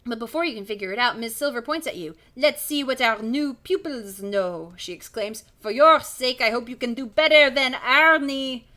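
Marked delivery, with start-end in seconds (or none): 2.3-4.7 slow, loud French quizzing; slow French-accented teacher's question. Acted. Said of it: "Really committing to that accent". 5.6-8.6 put-on strict teacher with a French accent, louder; stern French schoolmistress greeting the class. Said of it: "Really committing to that accent".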